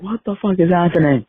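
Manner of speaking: high, baffled mock outburst; confused stranger. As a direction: play the stranger totally lost. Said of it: "He's like".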